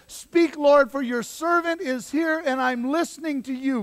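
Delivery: high, ringing declaration to God; fervent answer. Acted. Boy Samuel answers God with arms lifted, fervent declaration.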